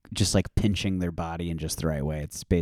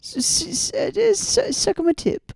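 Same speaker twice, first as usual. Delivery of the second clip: very high stammering falsetto; squeaky falsetto. Acted. Friend joins the bit in a very high squeaky voice. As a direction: squeak it out in a tiny cartoon voice.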